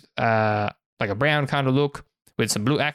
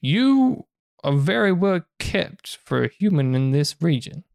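higher-pitched merchant sizing up a customer; drawn-out, curious trader's appraisal; 7 semitones higher than usual. Acted. Merchant addressing a well-kept human, lightly voiced appraisal.

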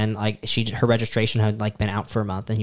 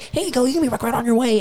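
high, chirpy fake friendly cop; customer service voice; 12 semitones higher than usual. Cop waving the driver off, in a mock cheery voice. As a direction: sing it out like a too nice cop. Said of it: "customer service voice".

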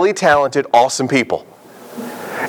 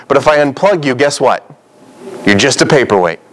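usual speaking voice

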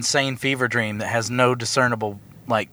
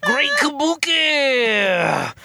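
high, slow shouted wrestling promo; Dusty Rhodes promo; 13 semitones higher, much slower than usual. Retold or imitated. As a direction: bellow it slow like a crazed wrestler. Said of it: "it goes a little something like this".

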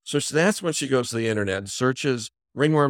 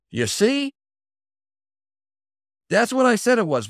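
much higher, heated I-told-you-so outburst; mother going ballistic; 13 semitones higher, 4 dB louder than usual. Retold or imitated. Mother turning on her teenage daughter, high heated outburst. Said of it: "the mother goes ballistic".

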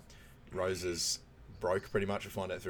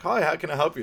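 higher, louder chirpy receptionist greeting; 7 semitones higher, 8 dB louder than usual. Retold or imitated. A receptionist greeting visitors, chirpy and higher. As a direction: chirp the welcome like a front desk.

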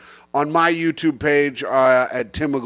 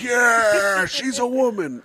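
high, whooping audience response; mock crowd cheer; 7 semitones higher, slower than usual. Comic voices audience reacting to the bit, high mock cheer. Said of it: "everyone's like".

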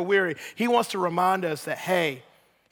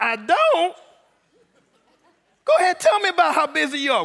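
high, lightly hammed-up incredulous God; mock-surprised God; 8 semitones higher, 4 dB louder than usual. God humoring a busy believer, high mock surprise. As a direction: lightly play God egging them on, pitched up. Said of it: "And God's like".

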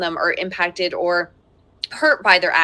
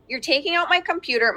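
usual speaking voice

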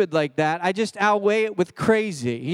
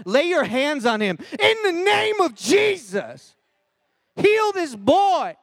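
fervent prayer; 8 semitones higher, slower than usual. Acted. Girl praying over the pushy boy, high slow fervent cry. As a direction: pray over him full force.

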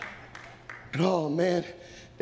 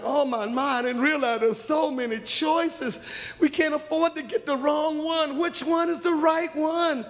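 acted anxious overwhelm; dismayed girls; 10 semitones higher than usual. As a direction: let the worry climb to a raised plea. Girls dismayed by too many choices.

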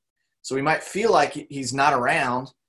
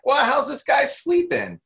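high-pitched, slower mock panic; mock freaking out; 4 dB louder, slower than usual. Disciples freaking out while Jesus sleeps on the boat, mock panic. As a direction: imitate alarmed onlookers, pitched way up. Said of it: "everybody else is freaking out".

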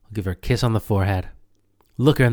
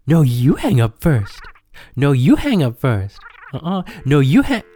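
higher, louder lover's whine; playful whining; 5 semitones higher, 5 dB louder than usual. Acted. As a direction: whine it like a smitten teen. Escalating "you hang up first.

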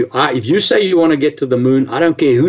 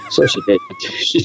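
laughing. Real, not acted. Laughs at wife ignoring warning.

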